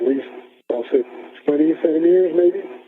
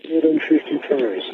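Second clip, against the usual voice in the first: helmet radio. Real, not acted. Astronaut calling turns on spacewalk.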